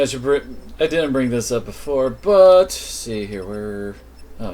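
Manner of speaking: ordinary delivery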